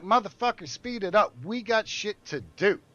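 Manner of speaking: high, loud order to hurry up; impatient yell. Retold or imitated. On the hot flight line, yelling at the lazy coworker to hurry.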